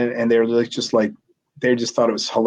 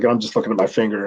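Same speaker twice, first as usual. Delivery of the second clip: normal talking voice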